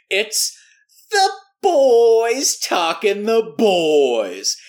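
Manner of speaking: big hammed-up show intro; announcer reveal. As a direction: drag out the title like a trailer voice. Announcing podcast title.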